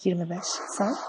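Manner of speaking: soft, quiet put-on woman's voice; man playing a woman softly on the phone; man doing a woman's voice. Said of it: "Oğlum ben kadın sesi yapamam ki".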